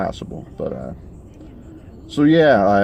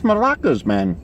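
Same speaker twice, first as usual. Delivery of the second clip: much higher mock Mexican accent; rough Mexican accent; 6 semitones higher, slower than usual. Stranger tells the musician what the shakers are, mock Mexican accent. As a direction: imitate a Mexican man naming an instrument, pitch jumping up. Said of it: "how to do a good Mexican accent".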